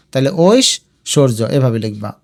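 ordinary delivery

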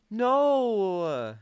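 long, high, loud wail; anguished cry. Real, not acted. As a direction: cry out as the attempt fails. Fails again at the obstacle.

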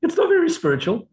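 high mocking voice of the ego. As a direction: mimic the nagging inner ego. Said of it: "the ego personality is still there going".

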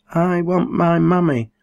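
put-on high, whiny child; child's whine. A narrator's reading. Rob wanting his mother, high and whiny. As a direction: beg like a tired toddler.

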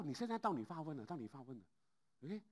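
usual speaking voice

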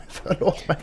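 unvoiced whispered sorry; a sheepish whispered sorry; whispered apology. Real, not acted. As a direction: breathe the apology.

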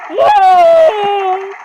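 loud shout of a name; calling out. Real, not acted. Gamer shouting for teammate Jake, loud call. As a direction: yell for Jake across the fight.

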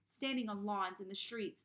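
ordinary delivery

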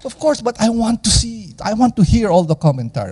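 ordinary talk, nothing marked

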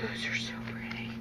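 awed whisper. Real, not acted. Admiring the cupcake wrappers to viewers, in a whisper. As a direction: breathe it out in wonder.

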